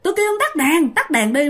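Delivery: high insistent shriek; possessed girl screaming. Retold or imitated. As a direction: keep screeching the demand. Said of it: "nó la làng". (cô gái bị nhập la làng đòi tắt đèn)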